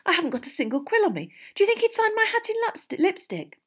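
put-on high, fast girlish chatter; frantic fangirl. A narrator's reading. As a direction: gabble like a starstruck schoolgirl. Frantic sixth-year girls want autograph.

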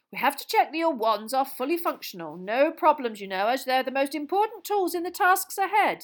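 a jolly official welcoming at full volume; booming Bagman. Acted. Jolly official loudly welcoming Harry into the room, booming and hearty.

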